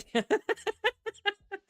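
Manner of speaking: sudden high helpless laugh; cracking up out of nowhere; burst of laughter. Real, not acted. Reader cracks up mid-story at a joke.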